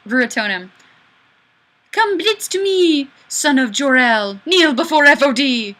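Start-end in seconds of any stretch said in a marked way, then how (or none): 1.9-5.8 loud, higher, commanding villain voice; mock villain. Streamer reading a viewer's comment as a booming villain. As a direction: boom it like a comic book tyrant. Zod-style villain quote.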